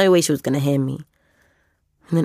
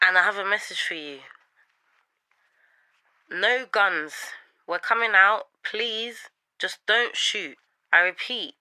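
higher, careful plea read out to camera; hostage message; 5 semitones higher than usual. Acted. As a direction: plead carefully, as if reading a prepared message. Recorded hostage message to police.

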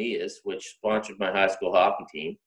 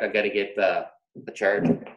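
ordinary talk, nothing marked

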